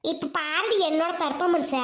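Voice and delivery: ordinary talk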